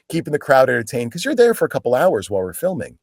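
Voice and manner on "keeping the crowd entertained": ordinary talk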